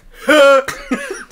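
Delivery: sudden shrill yelp of a laugh; a burst of yelping laughter from the crowd; loud high yelp. Retold or imitated. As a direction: blurt out a loud startled yelp. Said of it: "someone's like".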